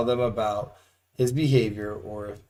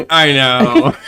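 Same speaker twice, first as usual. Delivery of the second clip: laughing; 4 semitones higher, 12 dB louder than usual. Retold or imitated. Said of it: "And I'm like".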